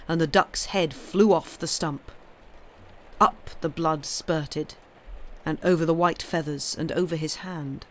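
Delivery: ordinary delivery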